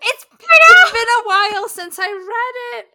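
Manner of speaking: high, loud laughing protest; laughing excuse. Real, not acted. Wife caught forgetting her husband's name, laughing it off loudly. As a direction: squeal the excuse through laughter.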